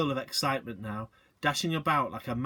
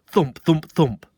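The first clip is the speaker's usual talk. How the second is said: loud thumps; 3 semitones higher, 11 dB louder, slower than usual. A narrator's reading.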